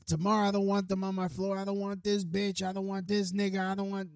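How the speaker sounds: mock ranting neighbor voice; imitating angry neighbor. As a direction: ham up his cursing tirade. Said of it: "this is how he's talking".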